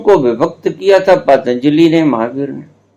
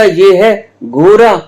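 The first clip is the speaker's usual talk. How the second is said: lightly voiced teacher, drawn out and higher; slow teacher drill; 6 semitones higher, 6 dB louder, slower than usual. Guru mimics the teacher drilling the class, slow and high. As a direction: spell it out slowly like a village schoolmaster. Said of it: "मुझे तो ये बोलना नहीं आता".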